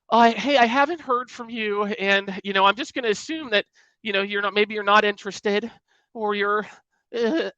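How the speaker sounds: high, whiny mock of a needy salesperson; desperate salesperson. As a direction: parody the clingy follow-up voicemail. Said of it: "you sound desperate".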